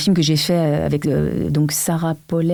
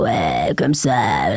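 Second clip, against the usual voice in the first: very deep low imitation; Jeanne Moreau voice; 8 semitones lower, much slower than usual. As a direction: drop the voice way down low. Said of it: "Elle a une voix plutôt".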